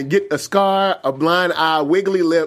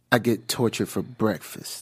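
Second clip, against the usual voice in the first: low, slow, lightly voiced tough guy; a hint of a gruff tough guy boasting; mock tough guy; 9 semitones lower, 8 dB quieter, slower than usual. Cohost voicing Ketch shrugging off the torture, low and slow. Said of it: "he's like".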